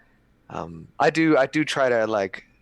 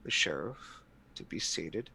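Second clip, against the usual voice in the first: soft, halting appeal to a superior; quiet, hesitant diplomacy with long pauses; careful entreaty; 7 dB quieter than usual. Acted. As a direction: choose each word carefully, voice held down. Player pleads with the prince.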